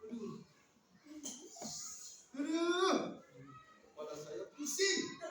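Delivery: acted pained moaning; mock sufferer's "aduh"; toothache groaning. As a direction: groan through an aching tooth. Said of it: "sedang sakit gigi".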